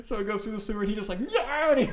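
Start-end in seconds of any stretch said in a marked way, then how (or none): 1.3-1.7 high-pitched mock yell; imitated yelp. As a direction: yelp like someone suddenly yanked away. Imitates pledge yanked off roof.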